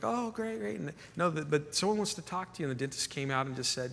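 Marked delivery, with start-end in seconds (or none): 0.0-0.8 higher, wry mock dismay; a rueful little oh great. Pastor hearing members skip church, wry mock dismay. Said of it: "I'm like".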